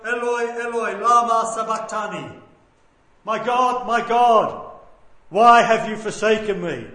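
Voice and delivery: loud, drawn calling out; heartrending cry. Retold or imitated. Preacher voicing Jesus forsaken on the cross, crying out. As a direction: call out in anguish to God. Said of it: "He calls out".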